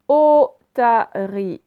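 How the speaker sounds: "Otarie" slow and loud, opening O held; syllable by syllable. Shown as a demonstration. Teacher showing O at the very start, syllable by syllable. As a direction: split "Otarie" into three, stressing the first O. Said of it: "je l'ai prononcé aussi au tout début".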